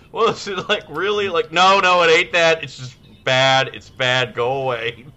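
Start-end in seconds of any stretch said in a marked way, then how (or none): 1.5-5.1 loud and high at first, fading toward usual by go away. Retold or imitated. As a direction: start loud, then wind down. Retold shooing coworker away.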